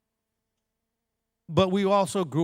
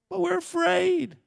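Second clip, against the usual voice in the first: whiny plea; slower than usual. Retold or imitated. Voicing whining people.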